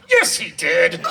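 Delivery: a playful imitation of a stubborn child yelling back; mock toddler holler. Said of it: "she hollered back at her mom".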